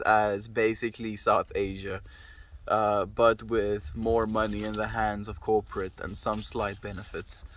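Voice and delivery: ordinary talk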